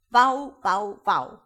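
very loud barking yells; barked chorus. Acted. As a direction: bark it out like a dog chorus. Chorus of the lullaby again. (laut bellend gerufen)

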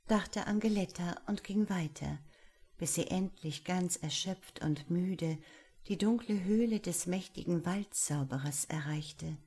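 usual speaking voice

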